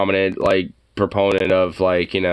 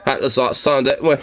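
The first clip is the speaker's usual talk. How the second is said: light mock Scottish accent, higher; 4 semitones higher than usual. Streamer echoing the man, lightly mock Scottish accent. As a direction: echo him with a hint of Scottish. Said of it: "it does sound a little Scottish".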